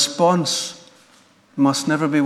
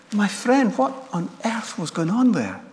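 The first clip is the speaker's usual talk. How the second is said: higher, lightly voiced rabbi asking softly; a hint of a kindly old rabbi, gently puzzled; gentle rabbi; 5 semitones higher, 5 dB quieter than usual.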